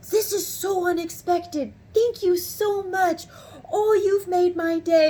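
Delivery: high, slow, drawn-out gushing; imitated overwhelmed thanks. Supervisor thanking customer for unexpected praise, overwhelmed. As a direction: gush as if overcome with gratitude.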